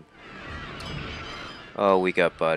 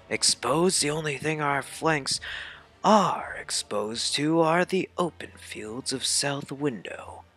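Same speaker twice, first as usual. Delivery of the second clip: higher-pitched commander giving battle orders; game army commander; 8 semitones higher, slower than usual. Acted. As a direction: voice a game army officer lightly. Reads out game villains' battle dialogue.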